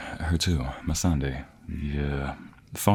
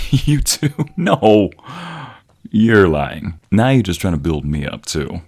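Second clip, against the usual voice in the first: playful protest; 3 semitones higher, 7 dB louder, slower than usual. Acted. Denying partner's flattery.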